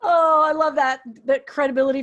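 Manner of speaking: bursting out through laughter, loud and high; loud laughing "oh, I love that"; delighted laugh. Real, not acted. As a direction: still laughing as the words come out, real delight. Host delighted by son's quip.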